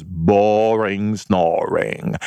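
very slow, stretched mock-bored; drawn-out boredom. Describing past dull Thanksgivings, drawn-out and bored. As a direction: drag it out like a yawn.

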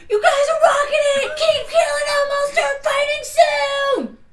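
high, loud battle yell; shout. Acted. Blinded player cheering on her fighting friends, shouting. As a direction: holler encouragement across the battlefield. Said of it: "I would like to shout".

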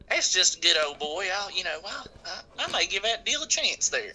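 put-on high-pitched good-old-boy musing; won-over country customer. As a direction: mull it over as a folksy, hesitant buyer. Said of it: "'Cause they'll be like".

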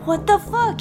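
ordinary delivery

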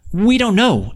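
lightly voiced children; children answering the giant; children's voice. A narrator's reading. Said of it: "answered the children".

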